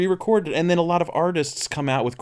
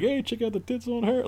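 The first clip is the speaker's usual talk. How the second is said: put-on high-pitched, softer kid voice; imitated nine-year-olds; 11 semitones higher, 5 dB quieter than usual. Nine-year-old watching a movie with a grown man, imitated boyish glee. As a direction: mimic a giggly schoolboy pointing something out. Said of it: "nine-year-olds saying this with, like, this, like, grown man".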